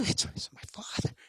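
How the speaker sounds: hushed whisper aside. Acted. Son whispers to himself at the moth, hushed. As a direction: whisper it in awe.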